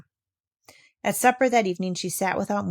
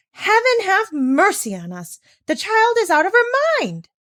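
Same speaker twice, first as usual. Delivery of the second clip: much higher, louder and slow horrified outcry; scandalized shriek; shocked cry; 12 semitones higher, 6 dB louder than usual. Acted. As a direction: throw up your hands in horror. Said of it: "cried Miss Rottermeier".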